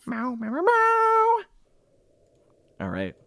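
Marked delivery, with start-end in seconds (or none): 0.1-1.4 cat meowing. Acted. Game master joking about the scroll in the mouth, cat meowing. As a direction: mew like a cat, climbing higher.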